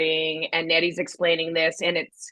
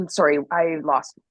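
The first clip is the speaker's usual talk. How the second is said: usual speaking voice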